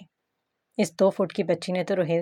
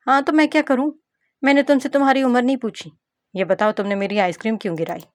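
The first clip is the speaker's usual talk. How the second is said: put-on a cheeky child snapping back, pitched higher; bratty little girl; 5 semitones higher, 4 dB louder, faster than usual. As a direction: voice a spoilt kid scolding a grown-up. Narrator voices the little girl. (गुस्सैल बच्ची की तीखी आवाज़)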